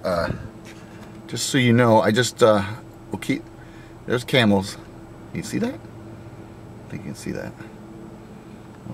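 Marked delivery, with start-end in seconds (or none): 5.3-8.9 whispering. Real, not acted. Whispering to camera near camels.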